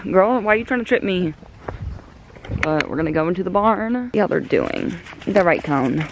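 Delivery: ordinary delivery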